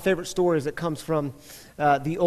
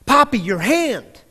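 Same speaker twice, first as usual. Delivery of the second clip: higher and louder, slow insistent scream; child screaming, louder; 5 semitones higher, 5 dB louder than usual. Retold or imitated. Daughter screaming at her father about his hand, louder mock scream. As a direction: push it up, now she's really screaming. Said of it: "she started screaming".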